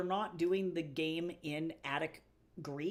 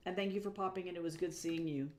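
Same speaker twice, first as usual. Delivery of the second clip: ordinary delivery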